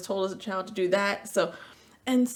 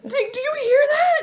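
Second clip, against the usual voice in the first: mother replaying her own snap at daughter, laughing shriek; mock shriek; 11 dB louder than usual. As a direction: ham up your own outburst, laughing. Mocking her own yelling.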